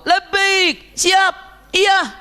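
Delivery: high-pitched yelled answer; acted son shouting his answer; shouted reply. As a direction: yell back to Mother from afar. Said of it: "jawabannya teriak".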